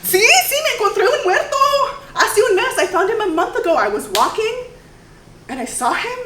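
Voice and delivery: high, loud, giggling as she tells it; laughing chatter. Acted. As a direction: blurt it out laughing, oblivious.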